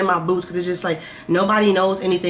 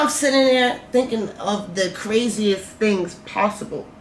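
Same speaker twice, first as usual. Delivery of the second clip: normal talking voice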